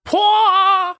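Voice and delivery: loud high delighted outburst; excited. Real, not acted. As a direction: burst out with delight. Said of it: "Ich werd excited".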